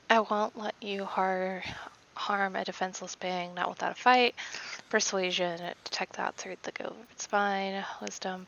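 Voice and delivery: usual speaking voice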